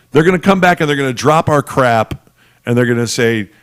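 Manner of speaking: ordinary talk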